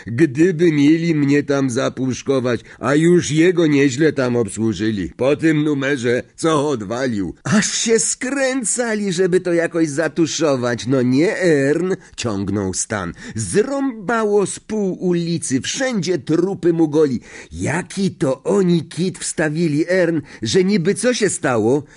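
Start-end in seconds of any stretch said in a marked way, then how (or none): 0.1-11.9 high, animated, swelling pitch; chattering conductor. Acted. Stan goes on about Azkaban to the driver, animated. 13.4-21.8 high, lively gossip voice; excited conductor. Acted. Stan asks driver about the cover story, excited.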